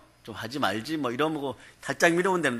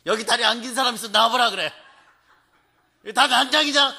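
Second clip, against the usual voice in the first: much higher, louder indignant outburst; mock yell; 8 semitones higher, 5 dB louder than usual. Old lady hollering at the subway car over leg room, high and loud. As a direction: bellow it at the whole car in mock outrage. Grandma yells at the whole car.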